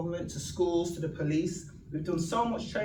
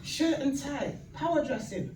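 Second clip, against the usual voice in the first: imitating the minister; 9 semitones higher than usual. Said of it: "He went".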